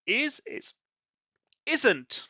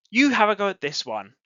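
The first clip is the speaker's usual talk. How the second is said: ordinary delivery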